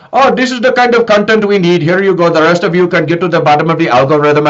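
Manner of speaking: lightly imitating an Indian tech guy; Indian accent. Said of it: "like, East Indian, like, tech guy".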